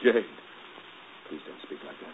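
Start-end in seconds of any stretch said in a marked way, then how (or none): 1.3-2.1 hushed plea, much softer; whisper. Acted. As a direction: breathe it out as a plea. Lover pleads softly.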